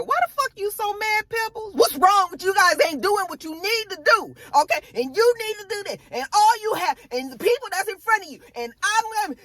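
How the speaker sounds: mock [name]. Recapper mocking [name] scolding the girls, high. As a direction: parody a fussing manager way up high. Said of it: "You know, I said".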